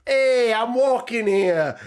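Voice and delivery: loud, high, drawn-out mock New York holler; put-on New York accent. Prankster bumping into New Yorkers, hammed-up accent holler. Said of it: "puts on the accent".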